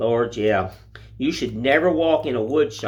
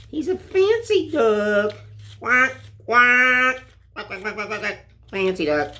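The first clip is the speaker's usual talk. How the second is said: high, playful duck voice; a high, cartoonish duck quacking; mock duck quack; silly duck voice; 9 semitones higher, slower than usual. Voicing the carved duck.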